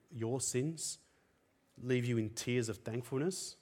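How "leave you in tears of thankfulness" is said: ordinary talk, nothing marked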